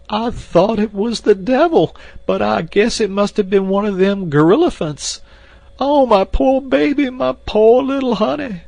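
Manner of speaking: very high, loud wailing maid voice; sobbing lament. A narrator's reading. Maid mourns the stolen Jane, high loud lament. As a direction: wail for her lost baby. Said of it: "again Esmeralda broke into uncontrollable sobbing".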